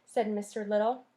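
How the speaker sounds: usual speaking voice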